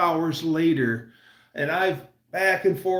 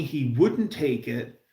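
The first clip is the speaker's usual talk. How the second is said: ordinary talk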